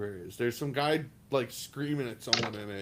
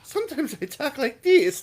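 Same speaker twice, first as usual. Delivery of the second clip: high, laughing put-on voice; silly put-on voice; 11 semitones higher, 6 dB louder than usual. Shown as a demonstration. Shown a friend's silly voice, laughing. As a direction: squeak it out through a laugh. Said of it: "sometimes she talks like this".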